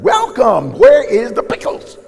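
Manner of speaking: teasing the helper by acting out the greeting; mock greeter. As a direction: play the greeter asking for pickles. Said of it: "mock greeter question".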